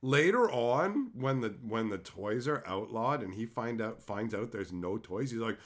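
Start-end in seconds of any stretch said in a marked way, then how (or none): none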